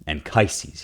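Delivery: normal talking voice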